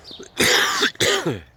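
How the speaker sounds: harsh chesty cough, high and loud; coughing fit. Real, not acted. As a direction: cough it out hard. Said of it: "As you can hear, I've still got it".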